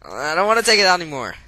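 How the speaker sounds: pleading not to touch it; phobic panic. Real, not acted. Refusing to touch burning phone.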